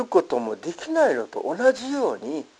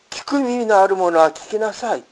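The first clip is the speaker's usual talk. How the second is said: raised, higher call from a scripture reading; mild narrated cry; 6 semitones higher, 5 dB louder than usual. A narrator's reading. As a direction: call it out firmly, short of a shout. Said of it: "と叫ばれた".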